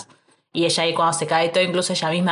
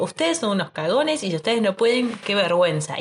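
mock boastful scolding; 5 semitones higher than usual. Ella desafía a los soldados cagones, mock boastful. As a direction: play a cocky heroine shaming her squad, pitched up.

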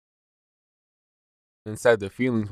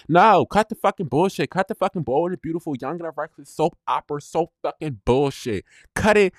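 angry outburst; 7 semitones higher than usual. Real, not acted. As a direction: cut through his excuses, fed up.

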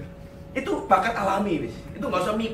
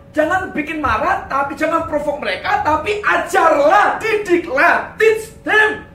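high, loud exhortation building to shouts; forceful preaching; 7 semitones higher, 6 dB louder, slower than usual. Real, not acted. Preacher urging fathers to teach their children, forceful shouting. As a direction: hammer each command home, rising to a shout. Preacher driving home the verse's command.

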